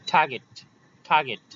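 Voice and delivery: "টার্গেট" with a puff of air on the t; airy burst opening "টার্গেট"; aspirated T. Shown as a demonstration. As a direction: repeat "টার্গেট" for pupils, t strongly aspirated. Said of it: "এভাবে".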